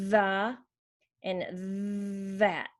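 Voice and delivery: stretched buzzing TH starting "the" and "that"; voiced TH. Shown as a demonstration. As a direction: hold the voiced TH in "the" and "that". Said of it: "Sometimes the voice is on".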